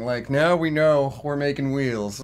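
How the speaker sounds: high, exaggerated sigh of relief; mock relief. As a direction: act hugely relieved at bad news. Acted relief instead of panicking.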